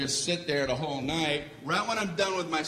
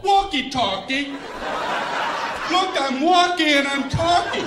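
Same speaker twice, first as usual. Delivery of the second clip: high, loud hammed-up toy voice; toy soldier voice; 11 semitones higher, 5 dB louder, slower than usual. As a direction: bark it like a cheesy talking toy. Robot toy voice bit.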